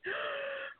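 sharp breathy gasp, played up; mock shocked gasp. Acting out her own shock at the repeated posts with a gasp. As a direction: gasp as if scandalized. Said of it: "and I'm like".

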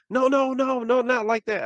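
high-pitched mock protest; flustered backtracking. Coworker denying she meant it, flustered protest.

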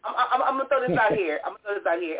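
ordinary talk

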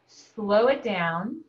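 drawn out slowly, each word stretched; slow it down as you say it. Shown as a demonstration.

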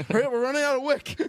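high, fast mock panic. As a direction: rush it like the fuse is burning. Joke about bomb on plane.

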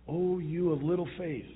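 "Oh, you of little faith." said with lifted, pitying compassion; compassionate. Shown as a demonstration. Jesus to Peter, the way the pastor believes it was said, compassionate and pitying. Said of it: "with a compassion and even a pity".

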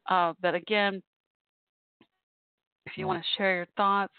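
usual speaking voice

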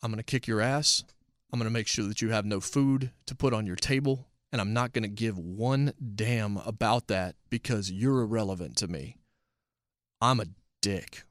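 low, soft, cold menace; calm, detached villain threat; measured threat. Shown as a demonstration. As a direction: quietly promise ruin like it's nothing. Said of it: "at a very measured pace".

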